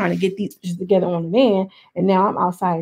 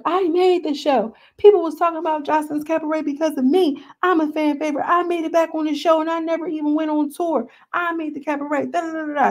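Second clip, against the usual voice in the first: emotional rant; 8 semitones higher than usual. Retold or imitated. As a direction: imitate her tearful boast, voice pitched up. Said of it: "she gets emotional".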